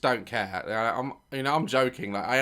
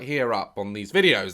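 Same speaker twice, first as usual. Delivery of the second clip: ordinary delivery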